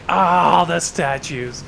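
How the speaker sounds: a loud groaning "Ah" then trailing off; mock exasperated sigh. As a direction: sigh at the statues like a fed-up player. Retold own sigh over statues.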